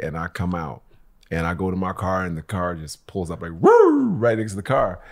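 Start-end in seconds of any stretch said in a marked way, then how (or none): 3.6-4.1 high yelping siren noise; imitated cop siren woo; mock siren whoop. Cop car pulling up, siren.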